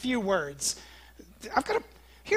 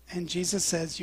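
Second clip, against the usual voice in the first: ordinary talk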